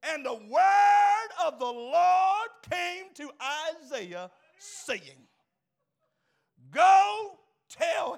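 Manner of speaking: very loud, high, stretched-out shouts; full-force preaching. Acted. Preacher declaring God's answer to the congregation, shouted at full force. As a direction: build to a roaring pulpit climax.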